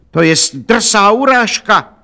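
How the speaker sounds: high, slow outraged cry; indignant exclamation. A narrator's reading. Count offended by shopkeeper's refusal, crying out indignantly. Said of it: "zvolal hrabě Rudolf z Kamelu".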